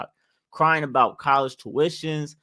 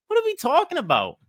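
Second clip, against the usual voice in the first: much higher, fast, incredulous repeat; exasperated disbelief; 14 semitones higher, faster than usual. Real, not acted. Vlogger repeating in disbelief at TikTok crying trend. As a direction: say it again in sheer disbelief.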